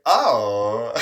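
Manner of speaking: a loud, stunned oh; stunned reaction to being told not to come back; shocked oh. Retold or imitated. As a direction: blurt it out in disbelief. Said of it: "like, in shock".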